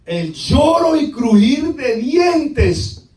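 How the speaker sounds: slow, high, loud proclamation; dire warning. Real, not acted. Preacher warning of weeping and gnashing, dire proclamation. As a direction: stretch the warning out forcefully.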